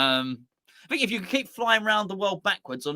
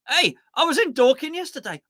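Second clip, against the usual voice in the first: bright, higher and louder, showing off the trip to chat; telling the stream chat excitedly about the day out; excited news; 8 semitones higher, 5 dB louder than usual. Shown as a demonstration. Eagerly sharing the Dorking trip with chat. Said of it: "There you go".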